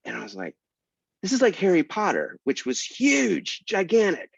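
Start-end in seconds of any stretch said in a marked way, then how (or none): 1.2-4.3 incredulous. Retold or imitated. Author protesting to the secretary, high and incredulous. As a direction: stretch huge in disbelief. Outraged about Harry Potter.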